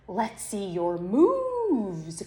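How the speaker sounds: pig's slow playful call. Acted. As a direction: draw out the dare. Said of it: "called Pig".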